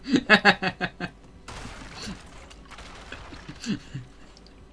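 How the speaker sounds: breathless high-pitched laugh; high laughter. Real, not acted. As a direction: burst out laughing. Cracks up at own story.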